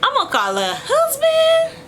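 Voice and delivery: tearful, high and slow; crying out she'll call the husband. Real, not acted. Upset friend threatening to call the husband, crying.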